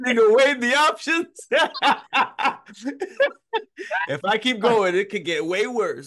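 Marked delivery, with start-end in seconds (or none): 0.1-3.6 loud laughing retort; shouting through laughter. Real, not acted.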